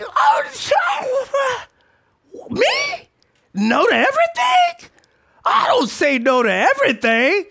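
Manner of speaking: high-pitched shrieking mock outrage; mock indignant disbelief. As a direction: protest the accusation in hammed-up shock. Dad's indignant reaction to sons' accusation.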